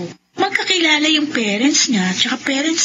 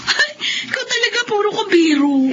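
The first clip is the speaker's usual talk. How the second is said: laughing; 6 semitones higher than usual. Acted. Embarrassed laugh at teasing.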